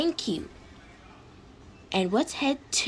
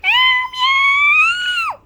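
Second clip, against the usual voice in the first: piercingly high, loud long meows; cat meows; 10 dB louder than usual. Acted. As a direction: squeal out drawn-out cat cries. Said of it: "That cat's extremely annoying".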